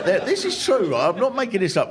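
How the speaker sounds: ordinary talk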